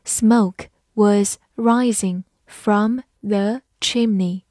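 slow, deliberate reading; slow read-through. Shown as a demonstration. Teacher reading the example slowly for students. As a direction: read each word slowly and clearly. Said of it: "好，慢慢来读".

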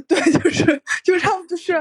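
words broken by laughter; laughing. Real, not acted. Reacting to the guest's group-buying joke, laughing. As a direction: laugh through the reply.

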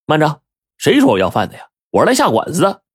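storyteller's mock shout; lightly acted shout. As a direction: object with indignation. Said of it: "五爷大喝一声".

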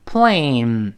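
"plane" drawn out with a falling glide; full diphthong. Shown as a demonstration. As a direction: stretch the ei in "plane" into the n. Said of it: "我们再读一下". (“plane”双元音拉长)

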